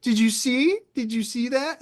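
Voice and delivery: high, cooing mock excitement; patronizing, high-pitched wonder; talking to a child. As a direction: imitate someone showing off a toddler's achievement. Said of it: "She acted like I was a little kid".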